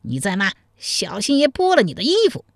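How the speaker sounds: high-pitched menacing threat; rising, bullying menace; vicious threat. Acted. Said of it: "恶狠狠地说道".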